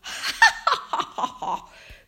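high, hammed-up cackling laugh; long descending peal of laughter; uproarious laugh. A narrator's reading. Missus Cox laughing at Franklin's answer, uproarious. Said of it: "laughed as if he'd cracked the funniest joke".

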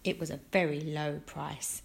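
plain reading voice

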